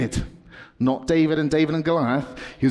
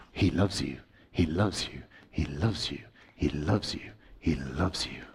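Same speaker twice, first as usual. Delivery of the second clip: softer each time, lower, ending in a whisper; fading to whisper; 10 semitones lower, 8 dB quieter than usual. Acted. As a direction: let it fade to a tender whisper. Repeated blessing, fading.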